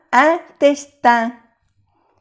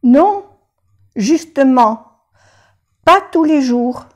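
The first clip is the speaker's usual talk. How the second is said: patient admits it to the doctor, mildly sheepish; patient's answer; 3 semitones higher than usual. Acted. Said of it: "contesta el enfermo".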